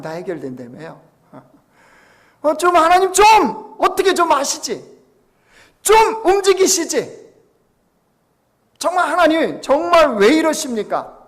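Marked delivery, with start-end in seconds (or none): 2.4-11.2 high, loud pleading cry; desperate cry to God. Pretend. Preacher voicing believers pleading with God, loud and high. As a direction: cry out to God, begging him to act. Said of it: "이렇게 말하고 싶지 않습니까".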